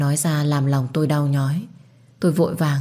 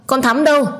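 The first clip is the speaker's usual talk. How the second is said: much higher, louder calling out; urgent shout; 10 semitones higher, 5 dB louder than usual. Acted. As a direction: yell for the maid in alarm. Mother calls for the maid. (gọi to hốt hoảng)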